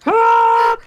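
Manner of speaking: high, loud shriek of shock; startled scream. Real, not acted. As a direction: yelp in sudden surprise. Game glitch startles him.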